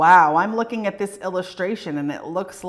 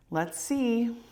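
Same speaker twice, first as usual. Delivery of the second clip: ordinary delivery, nothing marked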